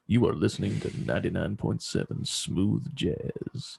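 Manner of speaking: slow, deep, sexy radio voice; smooth jazz DJ voice. Pretend. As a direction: croon it like a late-night jazz DJ. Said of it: "talk in slow, deep, sexy voice".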